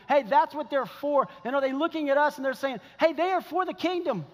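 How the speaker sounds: high, emphatic pulpit cry; impassioned preaching. Acted. Preacher voicing watching children about their parents, impassioned. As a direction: build to a fervent declaration.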